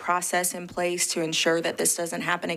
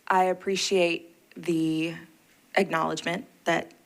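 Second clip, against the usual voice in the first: usual speaking voice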